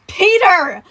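high, loud scolding shout of a name; snapped. Acted. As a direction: snap at a misbehaving child. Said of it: "Sun snapped".

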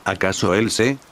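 ordinary delivery, nothing marked

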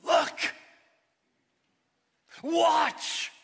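high, loud eager yell; playful child calling out; excited child's shout. Acted. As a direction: show off like a thrilled kid.

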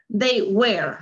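American "They were" with the R sounded; American accent. Shown as a demonstration. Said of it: "Aquí sí se pronuncia la R".

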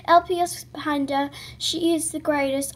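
usual speaking voice

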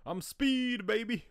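high, hyped-up cry; cocky mock excitement. Playing the speeding driver on screen, high excited whoop.

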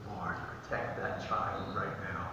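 low, very soft murmured prayer; hushed prayer. Retold or imitated. Praying for a child by the road, hushed. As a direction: pray it quietly under your breath. Acts out a quick urgent prayer.

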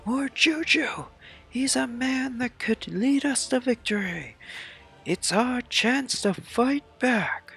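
very high put-on voice cheering troops on; rally the army in a falsetto; rallying game soldier. Acts game troops rallying behind Juju.

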